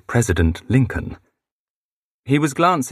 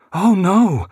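high, louder cry of alarm; dismayed cry; 11 semitones higher, 4 dB louder than usual. A narrator's reading. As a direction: gasp it out, caught off guard. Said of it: "Missus Stanton cried".

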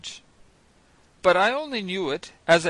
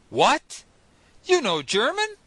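sharp rise on the question, a little louder; astonished exclamation; 7 semitones higher, 4 dB louder than usual. Acted. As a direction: blurt it out in disbelief. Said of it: "I exclaimed".

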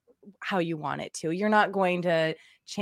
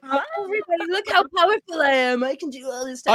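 much higher, louder, showing off to the whole street; grandiose mock preacher; 8 semitones higher, 6 dB louder, faster than usual. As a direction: proclaim your power like a hammed-up prophet. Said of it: "run into the streets and say".

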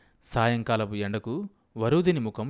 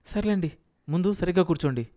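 higher, quick, mildly testy; irritated, covering up; 7 semitones higher, faster than usual. A narrator's reading. Wife to her husband in the cinema, covering her irritation. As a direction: brush it off with restrained irritation. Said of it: "చిరాకుగా కప్పిపుచ్చుకుంటూ".

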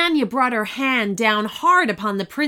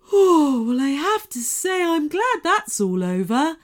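gentle unicorn voice; 7 semitones higher, 4 dB louder than usual. A narrator's reading. Unicorn speaking.

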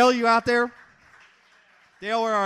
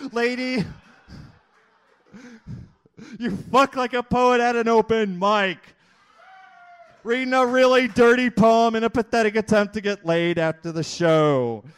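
slam poetry performance; 3 semitones higher than usual. Acted. Comic poet reading his raunchy poem to the crowd, declaiming. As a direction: declaim each line to the crowd like a poet on stage.

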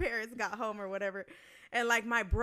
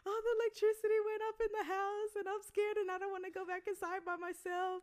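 whiny scared plea; 9 semitones higher than usual. Retold or imitated. Scared teen explaining to brother.

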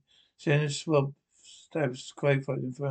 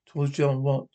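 plain reading voice

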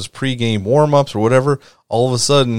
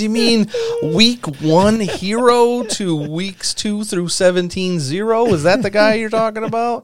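mocking voice; 12 semitones higher than usual. Mocking the flopped player.

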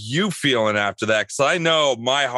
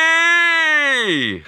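loud, high excited shout of a name; excited call; 5 dB louder than usual. Real, not acted. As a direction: call him in like a hype host. Welcoming the fan guest.

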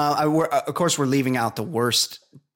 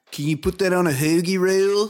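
higher-pitched attempt at a Philly accent; hammed-up Philly accent, pitched up; 7 semitones higher, 4 dB louder than usual. Shown as a demonstration. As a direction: exaggerate the Philly accent on "hoagie roll". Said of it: "can't really do it".

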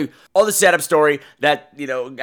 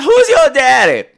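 loud, slow come-on line; mock suave come-on; 10 semitones higher, 9 dB louder, slower than usual. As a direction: ham up a slow, leering question. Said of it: "he didn't say it like that".

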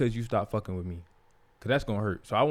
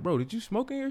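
shocked question; 11 semitones higher than usual. Retold or imitated. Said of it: "I'm like".

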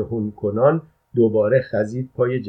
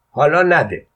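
angry retort; 5 semitones higher, 6 dB louder than usual. A narrator's reading. Father punches son.

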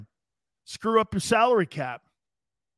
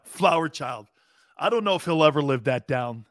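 ordinary talk

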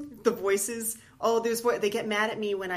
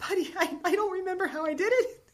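exasperated parent; 12 semitones higher than usual. Retold or imitated. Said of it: "And I'm like".

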